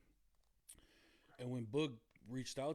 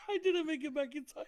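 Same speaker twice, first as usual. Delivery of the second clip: laughing; 12 semitones higher than usual. Real, not acted. Laughing about missing the deadline.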